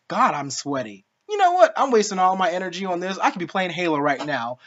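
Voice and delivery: ordinary delivery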